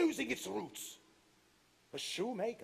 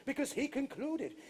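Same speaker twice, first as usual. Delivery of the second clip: usual speaking voice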